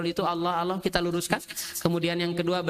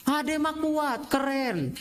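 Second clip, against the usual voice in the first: slow, higher mock praise for a child; drawn out like cheering a toddler; praising parent; 7 semitones higher, much slower than usual. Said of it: "Ini kita kadang-kala". (memuji anak, pelan dan tinggi)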